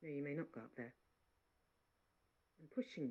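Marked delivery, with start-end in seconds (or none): 0.0-0.9 softer, toneless refusal; without expression. Acted. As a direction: flatly forbid her. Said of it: "without expression".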